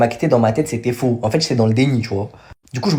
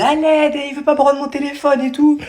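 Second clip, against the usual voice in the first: laughing, pitched-up imitation of the girlfriend; mock cry for help; 11 semitones higher than usual. Girlfriend calling for help outside her school, laughing high mock plea. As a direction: mimic her high plea while giggling. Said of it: "elle disait".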